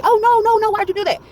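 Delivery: high, loud panicked no no no; panicked manager. Retold or imitated. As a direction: shriek like a frantic manager. Manager panics at the drive-thru girl.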